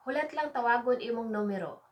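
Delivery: brisk, joined-up phrase; quick natural take. Shown as a demonstration. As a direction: run the words together at everyday speed. Same phrase repeated at natural speed.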